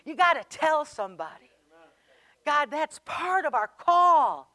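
loud preaching push; fervent exhortation. Real, not acted. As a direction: push them to speak up.

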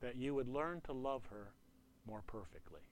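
softly repeated word from God; the Lord speaking. Retold or imitated. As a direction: let it sink in quietly, dropping away at the end. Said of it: "I heard the Lord say to me".

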